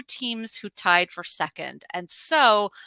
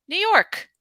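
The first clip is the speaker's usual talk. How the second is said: dramatic reveal; 12 semitones higher, 5 dB louder than usual. Real, not acted. Quiz host reveals the answer.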